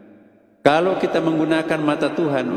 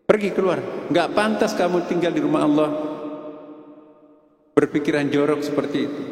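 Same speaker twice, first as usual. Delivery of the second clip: higher-pitched scolding tirade, fast on kenapa; furious kiai scolding a student in the mosque; angry kiai; 3 semitones higher than usual. Acted. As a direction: rebuke him for dirty thoughts in the mosque. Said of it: "Tidak pernah kelihatan kiai itu marah seperti itu".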